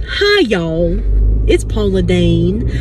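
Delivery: Southern TV cook greeting, low and drawn; Paula Deen. Retold or imitated. Doing her Paula Deen impression for the vlog, Southern drawl. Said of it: "I do a Paula Deen impression".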